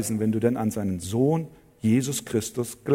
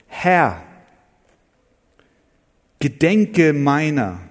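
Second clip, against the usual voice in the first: loud, slow scripture plea; emphatic reading; 3 semitones higher, 6 dB louder, much slower than usual. Shown as a demonstration. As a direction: proclaim the verse slowly. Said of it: "Luther übersetzt".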